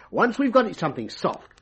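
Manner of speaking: usual speaking voice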